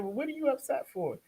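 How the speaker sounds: high-pitched question imitating the husband; mock challenge. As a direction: imitate his put-upon questioning. Retold manipulative husband questions wife.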